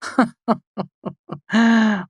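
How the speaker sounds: sharp, high, mocking laugh; derisive laugh. A narrator's reading. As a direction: laugh him down with scorn. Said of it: "sharp and full of derision".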